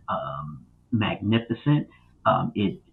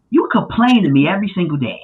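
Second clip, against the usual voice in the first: higher-pitched mock grumbling; exasperated, playful protest; retold complaint; 7 semitones higher, 3 dB louder than usual. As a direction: imitate yourself calling out a friend. Quoting his complaint to buddy.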